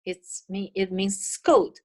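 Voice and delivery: normal talking voice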